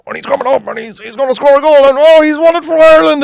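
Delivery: high, excited kid playing commentator; imagined match commentary. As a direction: build to a loud, high winning-goal call. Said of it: "mumbling to yourself and you're going".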